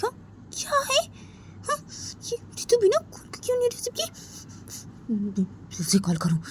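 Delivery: a hint of hurt worry; worried girl. Sneha waiting as Ritu ignores her call, softly worried. Ritu not answering calls.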